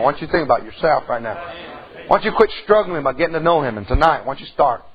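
ordinary talk, nothing marked

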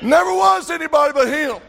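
preaching at full force. Real, not acted. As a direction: preach it out, climbing and shouting.

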